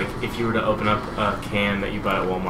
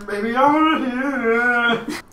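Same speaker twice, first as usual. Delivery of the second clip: hype call; 11 semitones higher, 6 dB louder, slower than usual. Acted. Hyping the crowd.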